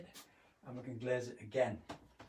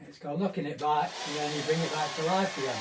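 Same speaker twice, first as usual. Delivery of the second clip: raised voice over a noisy dryer; over hair dryer; 3 semitones higher, 6 dB louder than usual. Real, not acted. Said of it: "I'm not talking over my hair dryer".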